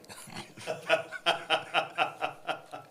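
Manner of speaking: a real, airy burst of laughter; breathy laugh. Real, not acted. Amused by the mother's ex husband's name, laughing.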